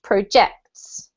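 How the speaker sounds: projects with the stress on -JECTS, the verb; pro-JECTS, second syllable stressed. Shown as a demonstration. Teacher modelling the verb for the class, stress on the second syllable. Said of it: "we have to emphasize the second syllable".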